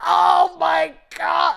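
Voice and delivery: loud, high, drawn-out exclamation; exasperated outburst. Retold or imitated. Podcaster retelling an infuriating snub, dramatic loud exclamation. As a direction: stretch it out in dramatic, loud disbelief.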